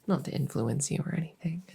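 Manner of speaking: conspiratorial whisper, breathy and hushed; sneaky little whispered aside. Acted. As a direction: lean in and whisper it like a secret. Sly aside to the listener.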